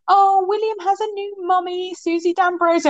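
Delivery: imitating a cooing friend; gushing post. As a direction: read it like a sugary announcement. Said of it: "she'd updated her Facebook saying".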